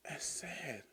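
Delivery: soft sad whisper. Real, not acted. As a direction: whisper it with a pang of pity. Sad moment in the story.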